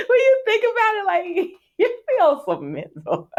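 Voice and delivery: high-pitched, laughing through the words; real laughing. Real, not acted. Looking back on how dramatic teens are, laughing. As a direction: crack up as you say it, voice shooting up. Laughing at teenage self-importance.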